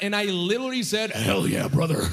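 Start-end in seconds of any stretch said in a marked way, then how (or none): 1.1-2.1 bro-style approval. Retold or imitated. Boyfriend to girlfriend in bed, bro-like approval. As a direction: voice dropped low, dude-like. Acting his clueless bedroom reply.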